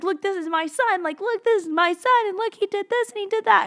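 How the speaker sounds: high, gushing show-off of a son; excited proud parent. Retold or imitated. God showing off his son's picture to everyone, excited. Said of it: "to see Jesus excited about us".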